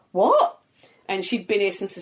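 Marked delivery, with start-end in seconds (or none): 0.1-0.6 high, loud stunned question; shocked. Retold or imitated. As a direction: react to unbelievable news. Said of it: "I'm like".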